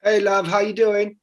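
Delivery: chummy fake British greeting; American faking a British accent; put-on English accent. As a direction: greet her as a mock English lad. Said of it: "Are you doing my accent?".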